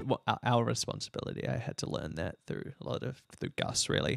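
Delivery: unvoiced meek whisper; whispered aside. Real, not acted. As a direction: whisper it sheepishly. Meek aside while Haley rages.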